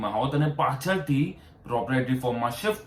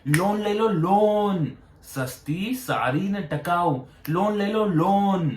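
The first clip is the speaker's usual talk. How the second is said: high, drawn-out vendor's sales cry; street cart hawker; 6 semitones higher, slower than usual. Retold or imitated. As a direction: hawk it like vegetables off a handcart. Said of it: "એના કરતા લારી લઈને કરતા હો તો". (લારીવાળા ફેરિયાની ઊંચા અવાજે બૂમ)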